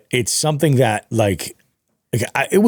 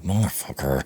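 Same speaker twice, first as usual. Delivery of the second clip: muttered curse; 6 semitones lower, 7 dB quieter than usual. Retold or imitated. Annoyed at boat near spot.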